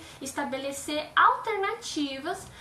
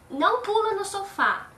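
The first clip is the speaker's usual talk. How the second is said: mock scolding parent; 7 semitones higher, 6 dB louder than usual. Shown as a demonstration. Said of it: "você fala assim".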